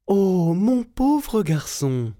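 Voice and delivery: kind giantess. A narrator's reading. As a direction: coo over the poor thin boy. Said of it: "dit la géante".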